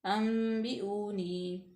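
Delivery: slow अंबिऊनी with a hidden nasal n; ikhfa nasal. Shown as a demonstration. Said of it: "थोड़ा सा गुना पैदा करेंगे".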